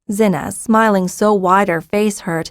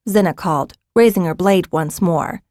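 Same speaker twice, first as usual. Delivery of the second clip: ordinary delivery, nothing marked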